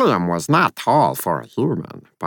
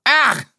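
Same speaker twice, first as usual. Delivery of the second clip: high squeak of alarm; high startled spirit cry; startled yelp; 4 dB louder than usual. Acted. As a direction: yelp as the cat pounces. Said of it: "Bob said".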